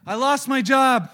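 high, loud hammed-up cheer; loud mocking churchgoer cheer; mock cheerful. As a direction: parody the chipper churchgoer. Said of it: "what I hear too often is like".

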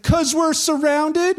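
high, slow, pleading whine; panicked servant. Retold or imitated. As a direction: stretch it out, close to panic. Servant panics again to Elisha.

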